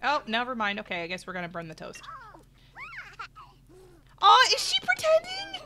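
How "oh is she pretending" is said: very high, delighted surprise; amazed. Real, not acted. Streamer surprised by the toddler pretending. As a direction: squeal at the cute discovery.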